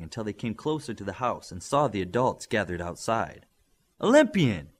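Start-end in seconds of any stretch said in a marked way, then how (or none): 4.0-4.7 loud, higher happy call of his name; father calling out to his arriving son, loud and glad; loud greeting. Acted. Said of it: "Mister Farakis said loud".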